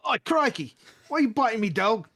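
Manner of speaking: high, loud mock-Australian yelp of protest; outraged Australian character. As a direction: protest loudly in a hammed-up Australian accent. Bitten character wakes, Australian accent planned.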